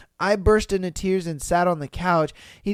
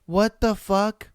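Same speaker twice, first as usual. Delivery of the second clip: ordinary talk, nothing marked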